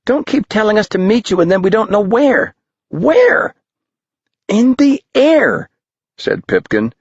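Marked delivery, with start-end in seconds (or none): 0.1-3.6 put-on high, quick, fed-up kid; a boy nagging his friend for answers; high fast boy voice, rising Where; exasperated boy. A narrator's reading. 4.5-5.7 put-on high, slow, dreamy kid; a boy giving a useless answer; high young voice, slow; airy boy answer. A narrator's reading.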